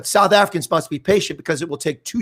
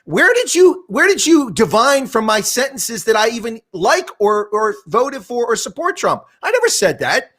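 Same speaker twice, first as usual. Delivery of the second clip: indignant retort; 7 semitones higher, slower than usual. Retold or imitated. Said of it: "I'm like".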